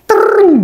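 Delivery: high trilled bullet sound; imitated gunfire ricochet. Soldier retelling enemy bullets arriving, imitated trilling gunfire. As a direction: trill high like rounds flying in.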